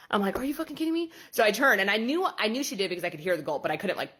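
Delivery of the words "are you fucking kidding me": disbelief. Retold or imitated. Disbelief at client sipping again.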